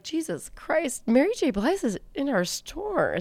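high, slow, hushed astonishment; awed reaction. Retold or imitated. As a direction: softly marvel at a star sighting. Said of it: "I was always like".

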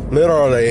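"literally" slurred, Philly-style; Philly slip. Real, not acted. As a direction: let "literally" come out loose and run together. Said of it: "I didn't say that well".

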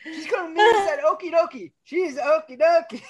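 high, fake-sobbing voice; mock crying. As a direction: whimper it like a crybaby. Quoting the movie, crying.

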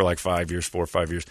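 ordinary talk, nothing marked

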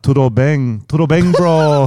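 exaggerated Brazilian Portuguese accent; mock São Paulo accent. Shown as a demonstration. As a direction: imitate a São Paulo local's accent, teasingly. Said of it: "started trying to speak like him to take the piss".

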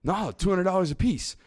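lightly acted pushy phone pitch; quilt salesman. Imitating a man selling quilts on the phone, lightly insistent. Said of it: "he's on the phone trying to sell the quilts".

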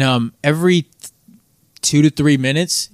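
ordinary talk, nothing marked

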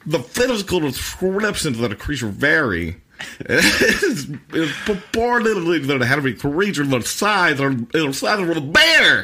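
high, loud, hammed-up commentator read; announcer voice. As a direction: boom it out like a TV announcer. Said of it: "read it like the announcer guy".

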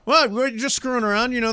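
ordinary talk, nothing marked

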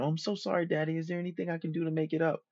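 put-on high, soft sweet girl voice; apologetic girlfriend.

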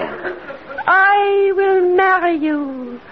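drawn-out dreamy repeat; slow dreamy echo. Acted. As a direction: stretch it out, entranced. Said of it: "Now repeat after me".

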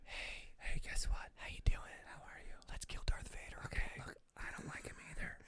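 hushed conspiratorial whisper, barely voiced. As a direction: whisper it secretively, like mock ASMR. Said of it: "They're whispering at each other".

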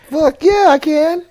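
high, loud excited squeal; mock eagerness. Asked to do it for Hensley, high eager yell.

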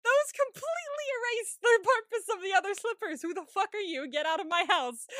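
high, loud outraged tirade; retelling rage at the caretaker, high loud mock fury; mock furious rant. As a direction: rant at him like he robbed you. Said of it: "I was like".

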